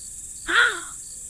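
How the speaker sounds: sharp high gasp of alarm; shocked gasp. Real, not acted. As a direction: gasp as if you saw a horror. Spots a gray hair.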